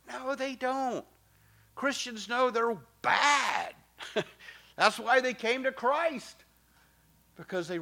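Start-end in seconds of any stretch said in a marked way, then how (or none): 0.1-6.4 high, insistent correction; emphatic rebuttal. Real, not acted. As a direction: push back hard on the claim. Pastor rebutting a common claim.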